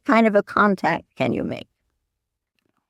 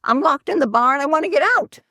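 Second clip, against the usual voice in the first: higher, hurried mock plea; comic desperate monk voice; pleading monk; 8 semitones higher, faster than usual. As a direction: blurt it out fast like a trapped monk. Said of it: "this monk says".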